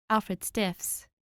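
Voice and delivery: plain reading voice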